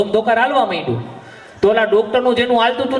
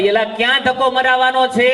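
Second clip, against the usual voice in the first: calling out; 4 semitones higher than usual. Retold or imitated. Neighbour calling out the door at two at night, shouting. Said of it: "દરવાજો ખોલીને રાડ પાડી".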